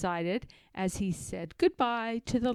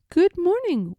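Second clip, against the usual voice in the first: Christopher Robin greeting; 9 semitones higher, 7 dB louder than usual. A narrator's reading.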